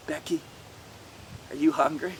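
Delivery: low, soft question; gentle. A narrator's reading. Jesus asking Becky if she's hungry, gentle. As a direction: ask a child gently and quietly.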